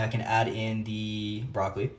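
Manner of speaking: usual speaking voice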